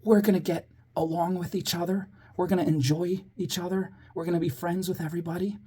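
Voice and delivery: very quiet, as if making a class lean in; softly spellbinding whisper to children. Retold or imitated. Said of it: "in a very quiet whisper tone".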